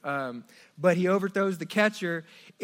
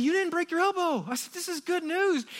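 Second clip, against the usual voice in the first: relieved excitement; 12 semitones higher than usual. Retold or imitated. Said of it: "I told Landon, I said".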